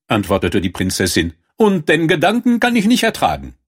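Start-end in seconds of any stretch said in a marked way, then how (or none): 1.6-3.5 high-pitched princess complaint; disgusted princess. A narrator's reading. Princess tells the king she can't bear it, high-pitched disgust. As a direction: object in a high voice. Princess protests to her father.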